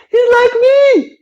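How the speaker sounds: high, slow, thrilled; high slow delighted realization. Retold or imitated. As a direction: savour the discovery, drawn out. Realizing friend is like him.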